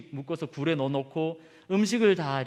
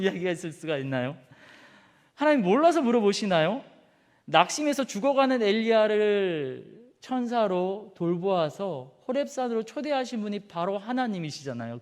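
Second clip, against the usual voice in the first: normal talking voice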